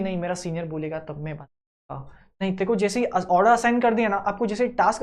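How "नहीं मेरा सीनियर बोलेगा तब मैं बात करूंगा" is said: lazy excuse. Retold or imitated. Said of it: "ऐसा नहीं".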